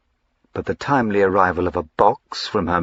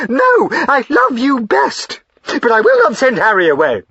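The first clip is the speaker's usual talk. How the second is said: much higher and louder, crying out; shrill defiance; 12 semitones higher, 6 dB louder than usual. Acted. Wife refusing to send Harry away, loud defiant cries. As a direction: refuse him at the top of her voice. Wife defying husband's order.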